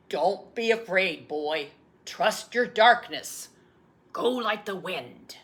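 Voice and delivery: steady rallying call; firm encouraging call. Retold or imitated. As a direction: urge him on from the sideline. Grandfather calling to boy.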